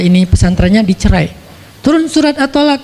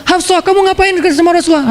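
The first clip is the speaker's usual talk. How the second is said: higher, mock scolding father; Umar scolding; 7 semitones higher than usual. Umar scolding daughter Hafsah, higher pitched. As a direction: scold her like an angry father. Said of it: "Langsung Umar marah-marah".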